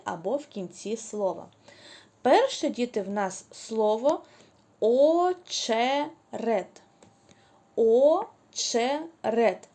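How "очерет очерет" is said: «очерет» stretched slowly, each sound clear; slow, drawn-out teaching pronunciation; drawn-out word. Shown as a demonstration. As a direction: pull «очерет» out very slowly so the «ч» is heard. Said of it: "Де ви чуєте звук «ч»".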